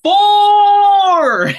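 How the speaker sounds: long, very high, loud golf yell; yelled back. Retold or imitated. Answering somebody yelling while walking out for gas, full yell. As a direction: holler it across a golf course, drawn out. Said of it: "so I yelled back".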